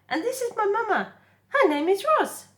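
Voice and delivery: high, loud gosling shout over the waves; excited shout. Acted. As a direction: call out across the water, thrilled. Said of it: "he shouted over the waves".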